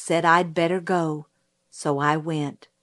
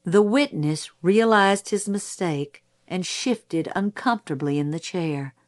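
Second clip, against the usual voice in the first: usual speaking voice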